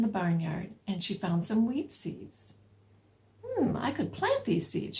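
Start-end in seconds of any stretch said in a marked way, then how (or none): 3.4-5.0 higher hen voice; a lightly voiced little hen; little red hen. Storyteller voices the hen to the children. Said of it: "she said".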